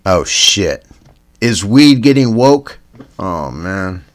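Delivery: ordinary talk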